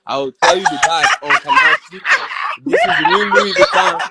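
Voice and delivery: loud, high hammed-up Nigerian street-seller accent. Showing off his impressions to friends, loud mock Nigerian accent. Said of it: "just got off the boat from Nigeria".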